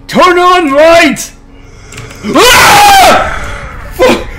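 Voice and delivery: high terrified yelling; real frightened shriek; panicked scream. Real, not acted. Monster in dark, panic.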